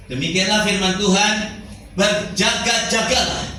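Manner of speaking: measured, declaimed Bible verse; scripture reading. Acted. Preacher reading Corinthians to the congregation, declaimed scripture.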